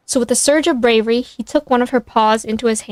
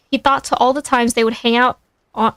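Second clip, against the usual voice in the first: usual speaking voice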